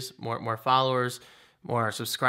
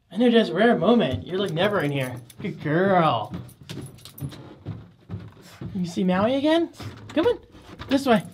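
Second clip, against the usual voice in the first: pet talk; 4 semitones higher, 3 dB louder than usual. Real, not acted. Praising and guiding the dog.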